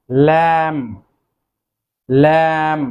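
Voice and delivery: lamb said as lem, slowly and clearly, b silent; careful pronunciation. Shown as a demonstration. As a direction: model the word lamb for the class. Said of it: "the next one is".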